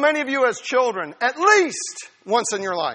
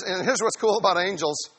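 ordinary delivery

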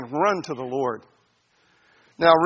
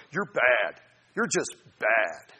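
slow, lower, lightly voiced scolding; condemning voice; 5 semitones lower, much slower than usual. Preacher mocking a wrong idea of the Holy Spirit, low scold. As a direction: drag out the scolding in a low put-on voice. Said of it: "the Holy Spirit comes and he goes".

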